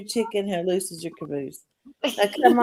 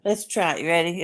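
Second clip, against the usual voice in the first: ordinary talk, nothing marked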